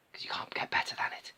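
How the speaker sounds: soft, whispered praise; hushed admiration. Real, not acted. Reviewer urging a repack of this figure, whispered admiration. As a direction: drop to a whisper, marvelling.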